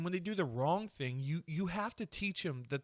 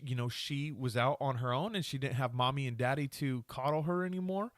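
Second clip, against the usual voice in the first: ordinary delivery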